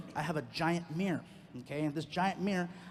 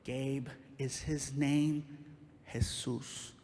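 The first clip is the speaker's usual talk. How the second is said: slow, hushed manager; 5 semitones lower, 3 dB quieter, much slower than usual. Retold or imitated. Manager voiced, slow, timbre shift.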